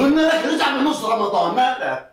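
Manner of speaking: exasperated. Acted. Friend arguing over the Ramadan plan, exasperated.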